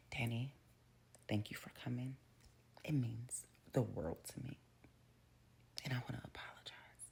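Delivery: soft whispered, oily sincerity; whispered insincere apology; hushed apology. Retold or imitated. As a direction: whisper it like a smooth false apology. Bill's fake apology to Danny.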